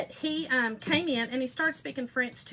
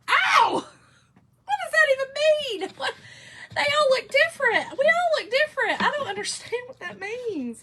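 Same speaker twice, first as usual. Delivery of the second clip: very high, loud mock crying; hammed-up upset wail; 14 semitones higher, 8 dB louder than usual. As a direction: wail like a distraught teenager, exaggerated. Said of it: "I was really upset about that".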